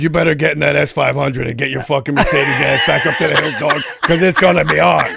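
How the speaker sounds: loud, laughing retold order; hammed-up send-him-packing holler; mock urgent warning. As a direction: bellow the warning while cracking up. Acted-out friend warning him.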